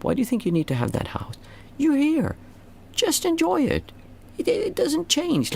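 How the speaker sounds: rising into a high, amazed inner voice; high-pitched inner realization. Retold or imitated. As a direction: voice jumps way up, sing-song and incredulous. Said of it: "you'll see, like".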